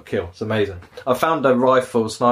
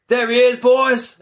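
high, loud bandit holler; sudden mock rallying yell; ambush call-out; 13 semitones higher, 5 dB louder, slower than usual. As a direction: shout to the gang, imitating an outlaw.

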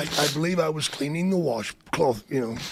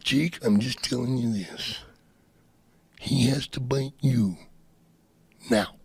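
low, insistent wrestler voice; low and deliberate, pausing before the last word; Macho Man; 7 semitones lower, 3 dB quieter than usual. Retold or imitated. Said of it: "That's a great Macho Man impression".

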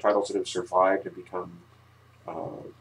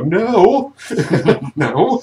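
loud, high laughing no; laughing refusal; 11 semitones higher, 11 dB louder than usual. Retold or imitated. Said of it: "would sit there and go".

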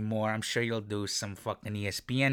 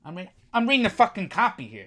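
loud, high-pitched irritation; annoyed, raised-voice protest; exasperated outburst; 9 semitones higher, 7 dB louder than usual. Real, not acted. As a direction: snap back at someone interrupting your read. Snaps at someone making faces.

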